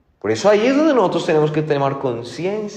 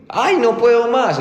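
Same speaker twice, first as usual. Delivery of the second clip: put-on child's cry; 10 semitones higher, 3 dB louder than usual. Child's shout heard in story.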